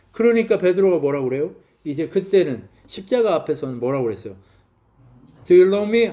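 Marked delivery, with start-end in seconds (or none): 5.4-6.1 raised, louder demanding question; louder mock Jesus asking Peter; Jesus asking loudly. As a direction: press him with the question.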